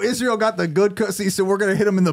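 high-pitched, laughing mock hype; hyped joking riff. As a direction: riff on it like a giddy, hyped-up bro. Joking riff on misheard word.

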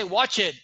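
"washed" said as "watch it"; learner's error, "watch it" for washed; Spanish speaker's mispronunciation. Shown as a demonstration. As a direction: turn SH to CH and add a syllable, "watch it". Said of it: "I don't pronounce the S H".

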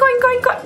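squeaky high oinking; high pig oinks. Retold or imitated. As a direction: oink like a little piggy. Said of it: "They go".

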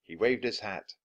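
plain reading voice

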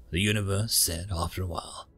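ordinary delivery

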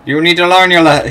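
mock-stern shout at a rival; loud scolding. Real, not acted. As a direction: yell the lesson at him.

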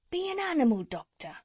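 high, quick, urging tradesman; cat's meat man. A narrator's reading. Cat's meat man urging the doctor, high and brisk. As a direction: press the advice home, high and brisk. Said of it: "answered the cat's meat man".

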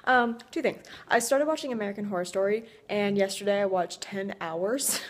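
ordinary talk

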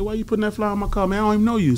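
play-confront him with a grin in the voice; joking challenge. Retold or imitated. Joking with the promoter about the flyer, mock challenge. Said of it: "I said".

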